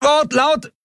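high-pitched yell through noise; shouted repeat. A narrator's reading. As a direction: call it across the roaring engines. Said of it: "er wiederholte es".

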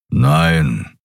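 grumbled low no; gruff creature grumbling his answer; low grumble. A narrator's reading. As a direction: growl the no in a low voice. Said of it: "brummte Kero".

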